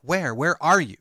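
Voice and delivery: retold exasperated officer, punching the words; insistent questioning. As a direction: push hard for the location. Retold officer demanding a location.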